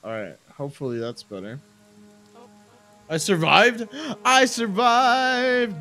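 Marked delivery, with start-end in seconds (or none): 3.1-3.9 loud, raised-pitch exclamation; short triumphant outburst; exclaiming. Real, not acted. Celebrates surviving a stream mishap. 4.2-5.8 loud, high, stretched-out holler; long, soaring victory yell; drawn-out triumphant cry. Real, not acted.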